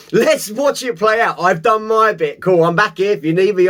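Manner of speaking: normal talking voice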